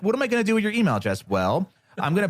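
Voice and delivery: high, fast, snide aside; mock sarcastic question. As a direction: fire it off like a smug pop-up. Mocking pop-up sales pitch.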